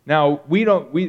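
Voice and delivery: ordinary talk, nothing marked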